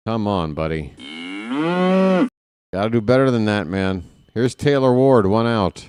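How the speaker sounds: play-by-play. Acted. Live play-by-play call.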